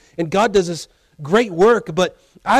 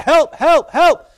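building shouts for help, rising high; escalating cry of a drowning swimmer; louder cries; 9 semitones higher, 5 dB louder, slower than usual. Acted. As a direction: push each help louder than the last. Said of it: "Louder and louder".

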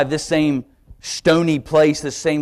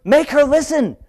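higher, insistent demand to God; prideful prayer; 9 semitones higher, 3 dB louder than usual. Retold or imitated. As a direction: push God to make her listen.

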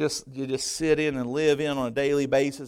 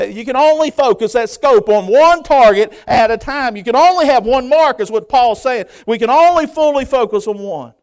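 loud, high-pitched sermon delivery; full-force preaching; 10 semitones higher, 14 dB louder than usual. Real, not acted. Preacher urging church toward the mark, full-force preaching.

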